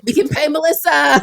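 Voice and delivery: loud, high celebration; excited cheer. Real, not acted. Host celebrating that ads bring money, loud and excited. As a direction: shout the good news in delight.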